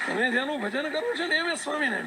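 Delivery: high, loud proclamation; emphatic revelation. Retold or imitated. Maharaj's reveal repeated emphatically.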